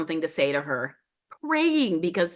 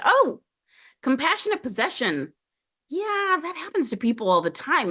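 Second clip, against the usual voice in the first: much higher, airy and offhand, as if it's nothing; breezy shaman; 8 semitones higher than usual. Retold or imitated. Shaman casually explaining to a confused client, high breezy lilt. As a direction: toss it off like the most ordinary thing.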